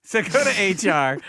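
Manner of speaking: high, loud, emphatic repeat; loud exclaimed echo. Acted. Repeats the line back loudly.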